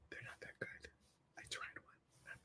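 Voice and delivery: hushed confession, no voice at all; whispered aside. Real, not acted. Telling viewers the donuts disappoint, whispered aside. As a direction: whisper it like a guilty secret.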